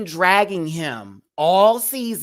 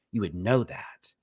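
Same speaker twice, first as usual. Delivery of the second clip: usual speaking voice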